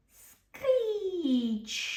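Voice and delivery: high, falling screech of brakes; imitated screech. A narrator's reading. Thomas's wheels grind to a stop, screeching. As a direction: squeal like train wheels grinding to a halt.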